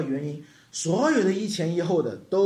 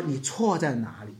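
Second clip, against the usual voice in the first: put-on soft, quiet questioning of a child; a parent softly asks a child what they did wrong; gentle asking; 7 semitones lower, 5 dB quieter than usual. Shown as a demonstration. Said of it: "你必须要告诉这个孩子说".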